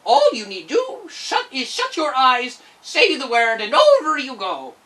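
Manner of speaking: high, loud, slow sly little man; ferryman. Acted. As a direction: coax the girl across. Ferryman instructs Dorothy.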